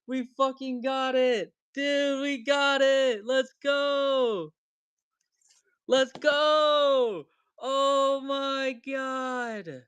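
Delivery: high, drawn-out shouts of victory; hyped shouting. Real, not acted. Streamer shouting to the buyer about the pull. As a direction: yell it out, pure hype.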